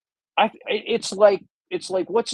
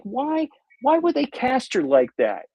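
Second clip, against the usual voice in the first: puzzled; 7 semitones higher than usual. Retold or imitated. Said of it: "I'm thinking, like".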